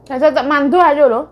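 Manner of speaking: loud, playful and excited; excited loud reaction. Pretend. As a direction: call it out with glee.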